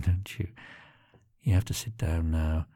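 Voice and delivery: ordinary delivery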